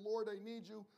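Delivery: cried out. Retold or imitated. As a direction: call out the prayer with urgency. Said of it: "as I cried out to him".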